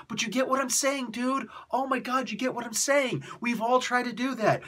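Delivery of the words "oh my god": ordinary delivery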